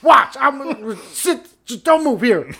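high, loud bossy salesman; a hammed-up pitchman shouting orders; mock loud demo. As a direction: order them into the box. Mock spit test demo.

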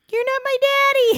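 put-on very high, loud child voice; toddler voice. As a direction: sass it like a little kid. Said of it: "and say".

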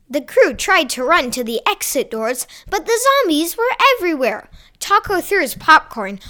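ordinary delivery, nothing marked

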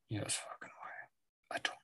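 unvoiced whisper answering himself. Real, not acted. Answering his own disbelief in a whisper. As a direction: whisper the reply back to yourself. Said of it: "No fucking way".

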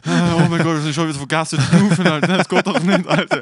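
loud, high, laughing outburst; cracking up. Real, not acted. Friend realizing they forgot to breathe, cracking up. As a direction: blurt it out through helpless laughter. Breaks up laughing over forgetting.

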